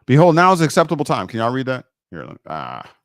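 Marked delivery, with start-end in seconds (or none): 0.0-1.3 scripture reading. Acted. Reads scripture aloud.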